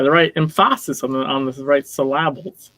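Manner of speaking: playfully misplace the stress in "emphasis" and "syllables"; joking wrong stress. Pretend. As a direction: say "emphasis" as em-FAH-sis, middle syllable stressed. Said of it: "you gotta try and put some in".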